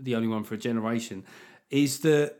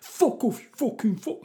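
put-on high teenage boy voice; swearing tirade; 10 semitones higher than usual. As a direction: imitate a mouthy boy. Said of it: "Why do teenage boys say".

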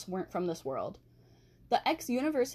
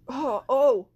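surprised; 4 semitones higher, 9 dB louder than usual. Real, not acted. Startled by own burp.

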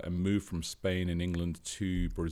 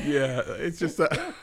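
high-pitched, giggly delivery; tickled, voice lifted by laughing; 11 semitones higher, 6 dB louder than usual. Real, not acted. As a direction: talk through your own laughter. Laughing after his accent demo.

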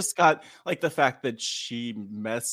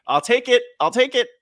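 much higher, louder eager grab; 10 semitones higher, 6 dB louder than usual. Retold or imitated. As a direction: happily accept every hug. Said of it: "I'm like".

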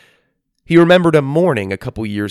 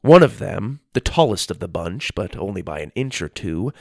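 ordinary delivery

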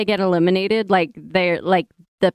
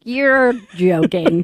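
slow, louder mock Irish "You're joking", drawn out; Irish accent, stretched slowly and pushed out loud; 5 dB louder, much slower than usual. Friend shrugging off Irish accents as fake, in mock Irish accent. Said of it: "When I hear an Irish accent".

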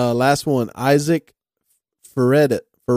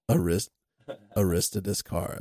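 low, softer sick voice; 4 semitones lower, 6 dB quieter than usual. Shown as a demonstration. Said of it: "Can you say it in your low, sick voice?".

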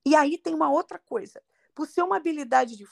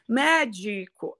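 Médico drawn out slowly, MÉ strongest, other syllables audible; first-syllable stress; much slower than usual. Shown as a demonstration. Teacher showing Portuguese syllables, Médico slow with MÉ stressed. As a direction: stretch Médico, stressing MÉ yet sounding di and co. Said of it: "ainda escuto as duas outras sílabas".

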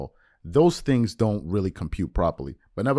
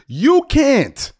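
high, loud and drawn-out refusal; stretched, high-pitched objection; emphatic rebuttal; 8 dB louder than usual. Retold or imitated. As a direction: shut him down, slow and loud. Retold argument, rebutting the man.

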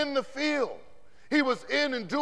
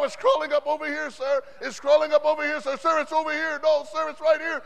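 loud, higher, fast frantic answers; panicked recruit; 5 semitones higher, 7 dB louder, faster than usual. Retold or imitated. Recruit feeling the bee crawling, loud frantic answers. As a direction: rattle it off in alarm.

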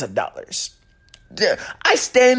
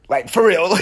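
laughing; 6 semitones higher than usual. Real, not acted. Laughs at own rant.

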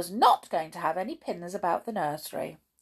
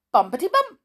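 bouncy bumpety; 9 semitones higher, 9 dB louder, much faster than usual. A narrator's reading. Comic fall sound.

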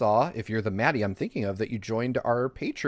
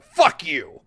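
loud, higher mock-aggressive outburst; mock furious yell; 5 semitones higher, 7 dB louder than usual. Sora kicking in someone's door, mock furious yell. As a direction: yell it like bursting through a door. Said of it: "kicking in someone's door and being like".

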